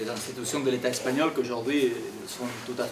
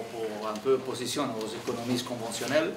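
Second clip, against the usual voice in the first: ordinary talk, nothing marked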